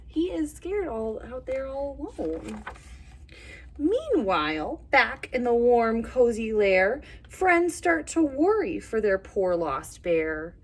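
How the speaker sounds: lively storybook read with voiced animals; reading to children. A narrator's reading. Teacher reading a bear story to little kids, animated. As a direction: tell it like a bedtime picture book. Reads picture book aloud.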